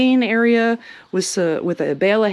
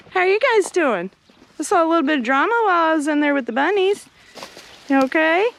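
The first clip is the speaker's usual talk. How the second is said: higher, quick chatter to the hens; bright sing-song check-in; pet talk; 7 semitones higher, faster than usual. Real, not acted. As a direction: chat up high to the chickens. Talking to the chickens.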